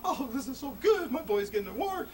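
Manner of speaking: mom's quote, high and excited; imitating a delighted mother; proud mom. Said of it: "she's looking at her boy going".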